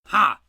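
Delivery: loud, high bark of a laugh; a sergeant catching a suspect out; triumphant ha. A narrator's reading. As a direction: shout it like a gotcha. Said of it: "Sergeant Webb cried".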